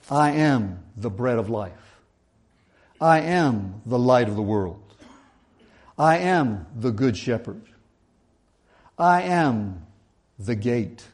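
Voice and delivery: slow, measured reading of each I am line; scripture recitation. Acted. Preacher reciting Jesus' sayings to the congregation, slow and measured. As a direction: lay each saying down slowly and deliberately.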